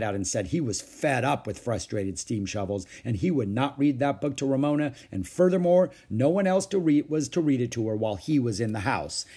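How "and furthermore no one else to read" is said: louder, higher emphatic decree; fed-up ruling. A narrator's reading. Father banning the steam shovel book, fed-up and emphatic. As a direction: lay down the law, mildly indignant.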